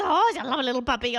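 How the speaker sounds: quick, high gushing coo; cover-up puppy talk. Retold or imitated. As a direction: coo over the puppy for the owner's ears. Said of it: "if she can hear him swearing at the puppy".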